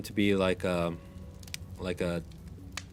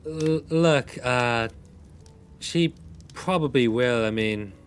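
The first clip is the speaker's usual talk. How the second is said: higher, louder nervous waitress; 4 semitones higher, 4 dB louder, slower than usual. Acted. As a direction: chime in as a jittery waitress. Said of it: "the waitress chimes up and goes".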